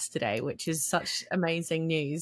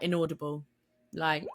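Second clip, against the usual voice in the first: usual speaking voice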